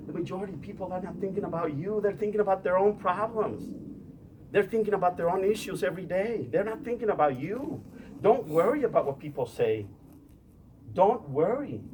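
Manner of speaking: rally the crowd, building up; fired-up preaching. Acted. Rising into full preaching.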